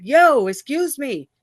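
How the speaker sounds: high, indignant retold call-out; sharp, high "excuse me" at the man who bumped her; confronting a stranger. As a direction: demand an apology from a stranger.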